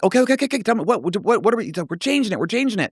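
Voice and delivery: high, stammering mock confusion; retold flustered panic. Wrestler reacting to a changed plan, retold flustered panic. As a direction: scramble to catch up with the plan.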